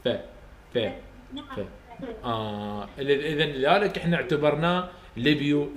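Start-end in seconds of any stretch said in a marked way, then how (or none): 0.0-1.6 فا with upper teeth on lower lip; softer classroom demo of فا; sound demo. Shown as a demonstration. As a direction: hiss the فا through teeth and lip. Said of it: "جربوا وياي انتو".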